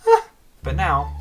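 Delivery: normal talking voice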